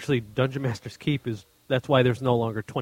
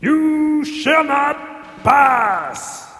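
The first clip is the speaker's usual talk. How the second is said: booming wizard voice; 13 semitones higher, 3 dB louder, much slower than usual. Pretend. Gandalf quote, effect voice.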